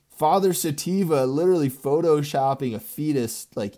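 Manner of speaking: ordinary talk, nothing marked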